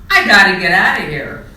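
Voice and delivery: butterfly snubbing the monkeys; loud exit. A narrator's reading. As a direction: shout it as you leave. Charlie refuses and leaves.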